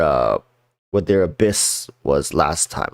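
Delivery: usual speaking voice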